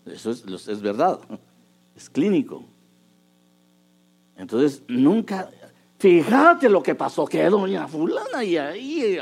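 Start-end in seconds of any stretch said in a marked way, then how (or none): 6.0-9.2 loud, high, rushed gossip; imitating a wife unloading gossip at dinner; mock gossiping wife. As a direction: blurt out the day's drama. Wife blurting news of the day.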